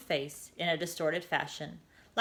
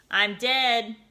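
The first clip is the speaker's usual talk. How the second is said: louder flat declaration; announced; 4 semitones higher, 6 dB louder than usual. A narrator's reading. Girl announcing to the sky, louder. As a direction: proclaim it to the sky. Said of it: "Ava announced to the sky".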